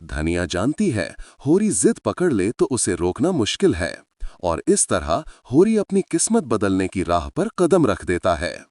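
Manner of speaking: ordinary delivery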